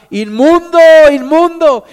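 high, loud warning shout. A narrator's reading. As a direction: bellow the word as a warning. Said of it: "el sacerdote gritaba".

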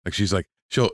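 usual speaking voice

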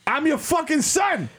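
slow, high furious yell; shouting. Pretend. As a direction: yell it at him. Son yelling at father.

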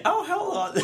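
very high-pitched, badly imitated North Carolina accent; hammed-up North Carolina twang, poorly done. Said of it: "trying to do a North Carolina accent".

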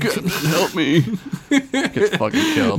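laughing. Pretend. Mocking a movie death, laughing.

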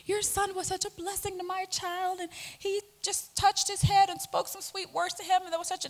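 lightly voiced church mom full of thanks; grateful church mom. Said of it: "moms come up to me at church saying".

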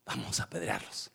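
whispered low threat; sinister whisper. Retold or imitated. Preacher voices the crowd plotting to stone them. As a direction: hiss the plan to stone them. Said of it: "Y ahora, minutos después".

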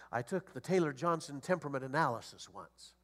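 ordinary talk, nothing marked

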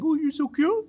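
put-on very high, squeaky baby-talk coo; cooing at puppies. Host answering haters as if cute puppies, cooing baby talk. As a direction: gush in falsetto as if to a tiny pet. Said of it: "you imagine that they're little bunny rabbits or little puppy dogs".

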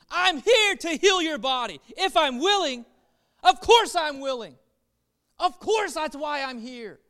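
insist, with rising passion, on being willing; preacher voicing Jesus' passionate reply; Jesus answering. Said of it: "And Jesus was like".

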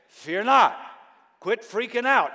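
booming, firm command; God's voice rings out loud. Retold or imitated. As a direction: declare it with divine authority. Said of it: "And God said".

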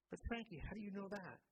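surprised. Acted. Mother startled by son's question.

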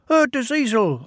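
high little engine voice; squeaky twin engine answering; Ben's high voice. Acted. Ben answering Bill, high and piping. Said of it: "replied Ben".

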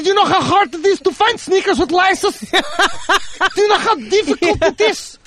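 Russian dad scolding. Pretend. Playing Russian dad scolding son.

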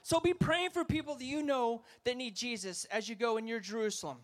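normal talking voice

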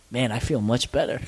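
retold relief. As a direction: quote him dropping low and quiet. Quoting relieved friend afterwards.

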